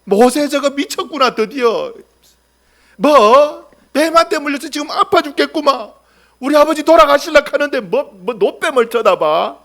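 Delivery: high, loud incredulous yelling; scoffing outrage. Acted. Bitten people mocking Moses' bronze snake, outraged.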